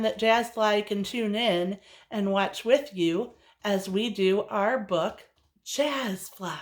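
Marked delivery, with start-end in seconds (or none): 5.6-6.6 breathy whispered name; hushed whisper to the kids. Acted. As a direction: whisper it like a secret. Teacher whispers name to children.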